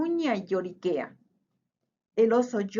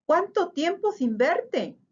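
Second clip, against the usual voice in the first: bright greeting pitched much higher; hare's happy greeting; 8 semitones higher than usual. A narrator's reading. Hare greets the mouse it has not seen, happily. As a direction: greet an old friend with delight. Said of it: "dice la liebre".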